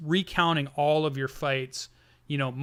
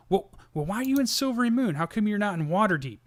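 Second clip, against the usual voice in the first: put-on higher, hurried drunken stage whisper; shout-whisper; 7 semitones higher than usual. Drunk gnome leaning in to a fighter, shout-whispering. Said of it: "and shouts whispers to you".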